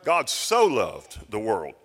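"so" stretched, slow and loud; stress on so. Shown as a demonstration. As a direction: dwell on "so" and slow the line right down. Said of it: "And I love that little "so".

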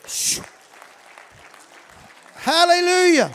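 ordinary talk

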